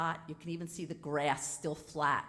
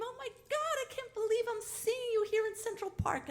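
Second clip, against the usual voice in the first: much higher shriek of joy; excited scream. Retold or imitated. Spotting an old friend in Central Park, high excited shriek. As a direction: squeal at seeing an old friend. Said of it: "and I scream".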